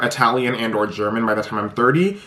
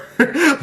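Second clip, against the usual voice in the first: high, loud burst of laughter; real high-pitched laugh; 14 semitones higher, 5 dB louder than usual. Real, not acted. Laughs at own sad-music habit.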